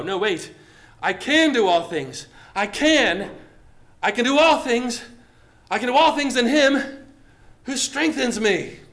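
building from firm to a high, loud proclamation; growing bold shout. Shown as a demonstration. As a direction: let faith rise until you shout it. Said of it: "faith beginning to grow".

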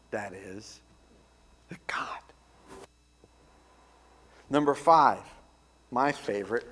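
fervent sermon delivery with big swings; preaching. Acted. Pastor preaching the I am sayings to his flock, rising and falling with fervor. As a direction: preach it to the congregation.

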